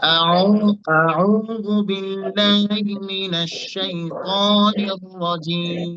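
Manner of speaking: slow chanted আউযু বিল্লাহি, রাজিম drawn out long; Arabic recitation, very slow; Quran recitation. Shown as a demonstration. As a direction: recite it slowly like tajweed. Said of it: "তিনটার উচ্চারণ same same হবে".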